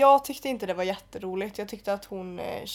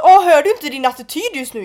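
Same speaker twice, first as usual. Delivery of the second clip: loud, higher-pitched indignant challenge; retold furious retort; 5 semitones higher, 11 dB louder than usual. Retelling how she confronted a cocky guy, furious retort. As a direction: call out his attitude, reenacting real irritation. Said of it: "så blev jag så jävla förbannad".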